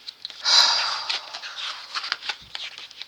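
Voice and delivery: long, voiceless exhale; heavy calming sigh. Real, not acted. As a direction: let out a slow, settling breath. Settling after saying calm down.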